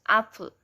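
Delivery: apple, first syllable strong, second reduced; stressed first syllable. Shown as a demonstration. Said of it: "first one is stressed and the other one is unstressed".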